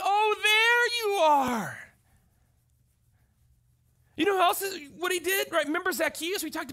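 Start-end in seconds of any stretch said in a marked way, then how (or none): 0.0-1.8 high, slow, loving greeting; Jesus warmly welcoming the children who came to him; tender recognition. Retold or imitated. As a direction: savour it like welcoming a lost child. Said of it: "and Jesus goes".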